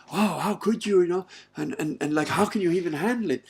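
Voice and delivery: high, loud, exclaiming disbelief; mock incredulity. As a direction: imitate people aghast and baffled. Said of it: "look at me like I'm crazy".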